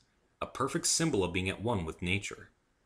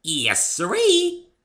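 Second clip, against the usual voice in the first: high, loud, bouncy pony voice; high loud cheerful pony girl; bubbly Pinkie; 13 semitones higher, 10 dB louder, much slower than usual. A narrator's reading. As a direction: chirp it like an excitable pony.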